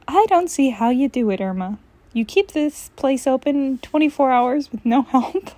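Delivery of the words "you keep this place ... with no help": ordinary delivery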